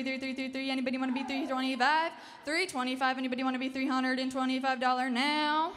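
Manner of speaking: rapid rhythmic auction chant; auctioneer. Acted. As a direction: rattle off bids in a fast chant. Live auction bid calling.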